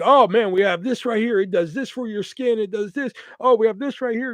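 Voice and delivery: eager salesman. Retold or imitated. Kiosk salesman pushing products on a shopper, eager patter. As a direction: imitate a seller piling on product after product.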